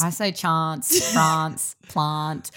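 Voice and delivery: "chants", "France", "plant" with a long ah; South Australian vowel. Shown as a demonstration. Answering how she says chants, long-ah vowels. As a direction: say "chants", "France", "plant" as chahnts, Frahnce, plahnt. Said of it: "Do you say chants or chants?".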